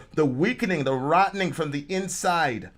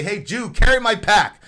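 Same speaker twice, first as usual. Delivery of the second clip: loud, higher-pitched barked order; nasty Roman soldier; 6 semitones higher, 7 dB louder than usual. Acted. Roman soldier ordering a neighbour to carry his pack, bossy. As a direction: play an oppressor throwing his weight around. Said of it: "you're a nasty, uh, Roman, uh, soldier".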